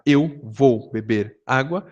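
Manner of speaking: Eu vou beber água drawn out slowly; slow wordy version. Shown as a demonstration. Teacher contrasting the wordy Brazilian phrasing, slowly. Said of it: "Em vez de eu falar".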